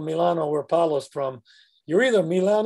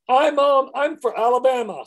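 eager Paul; 6 semitones higher, 5 dB louder than usual. Retold or imitated. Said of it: "says Paul".

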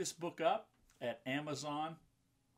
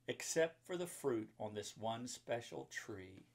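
put-on reading to children; 3 semitones higher than usual. A narrator's reading. Mom reading a Bible picture book to children, lively rhyming read-aloud. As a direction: bounce the rhymes for the kids.

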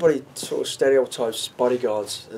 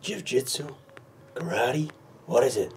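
put-on Clint Eastwood accent; 6 dB quieter than usual. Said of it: "he's that brilliant accent".

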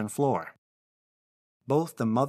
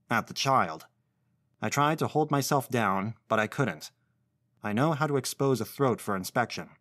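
usual speaking voice

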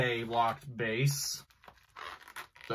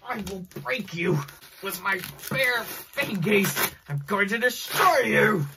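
barbarian; 5 semitones higher than usual. Pretend. Hammy villain threat to the box.

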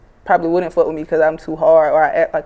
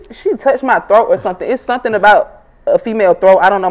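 ordinary talk, nothing marked

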